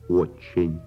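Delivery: ordinary delivery, nothing marked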